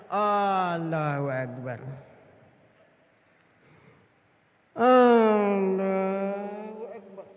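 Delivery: very slow, drooping takbir; dragged-out, dazed prayer; groggy, worn out. Retold or imitated. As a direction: imitate a fasting worshipper fading out mid-prayer. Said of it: "udah teler".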